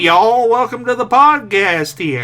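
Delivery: mock Southern Alabama drawl greeting; mock Alabama accent. Host greets listeners in a slow mock Alabama accent. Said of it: "We'll do it in Alabama accent".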